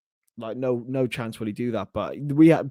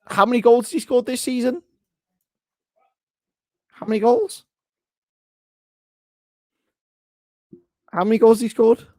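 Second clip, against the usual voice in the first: higher, louder disbelieving jeer at the question; incredulous mock outrage; 9 semitones higher, 5 dB louder than usual. Real, not acted. Streamer to chat, jeering at a striker's goals with incredulous shouts. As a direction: demand the answer in mock disbelief. Mocking striker's goal record.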